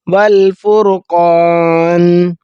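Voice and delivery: والفرقان slow, the ق full and heavy; heavy qaf. Shown as a demonstration. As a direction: recite والفرقان with a thick ق. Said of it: "قوف الف زبر قا". (ق پر کر کے والفرقان)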